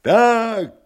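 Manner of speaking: high, loud hunted cry; wild cry. Acted. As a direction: snarl like a cornered animal. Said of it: "дико и затравленно озираясь".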